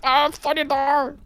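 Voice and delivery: very high, slow wail; dismayed wail. Real, not acted. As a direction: draw out a high cry of dismay. Wails as own side gets hit.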